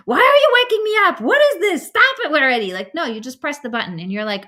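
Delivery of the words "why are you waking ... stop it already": high, loud, rapid outburst at a phone; mock screaming. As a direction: scream in exasperation, hammed-up. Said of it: "do you start screaming at your phone like".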